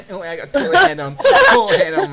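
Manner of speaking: high, loud imitated accent, cracking up; hammed-up thick accent through laughter; Gary McInnes. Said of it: "You mean, like, his accent?".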